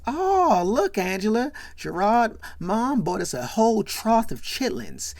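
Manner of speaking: loud, high-pitched mock excitement; excited announcement. Marcus pointing out the trough of chitlins, excited. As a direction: announce it big, acting out an excited friend.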